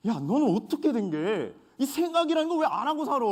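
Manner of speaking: high, loud scolding jab; scolding senior. Retold or imitated. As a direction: nag like a senior soldier. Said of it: "저한테 이렇게 이야기를 하는 겁니다".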